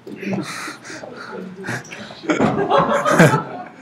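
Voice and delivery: short unvoiced chuckle; breathy laugh. Real, not acted. Speaker laughing at himself mid-speech, breathy laugh. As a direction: laugh at your own bit. Laughs at his own ham.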